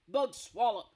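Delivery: very loud giant bellow; booming giant. Acted. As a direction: blast it out. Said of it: "he boomed".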